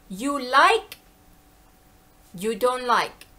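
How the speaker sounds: spaced short phrases, straight to business; blunt to-the-point imitation. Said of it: "they get right to the point".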